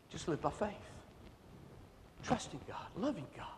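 a hushed, soft close. Acted. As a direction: let it fall to a hush. Soft closing of preaching.

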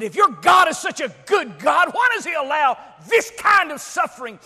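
high, shouted bitter challenge; derisive, sarcastic anger. Retold or imitated. Camp prisoner challenges the Bible teacher's God, angry derision. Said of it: "derisively and, and sarcastically and angrily, she said".

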